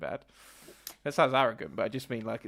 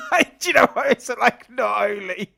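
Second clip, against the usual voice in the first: laughing; 7 semitones higher, 11 dB louder than usual. Real, not acted. Cracks up after own accent demo.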